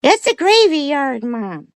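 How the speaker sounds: high, drawn-out dog voice; talking dog. Acted. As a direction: announce it slowly as a squeaky talking dog. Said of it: "he that comes out with".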